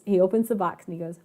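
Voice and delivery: ordinary talk, nothing marked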